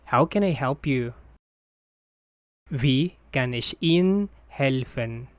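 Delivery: usual speaking voice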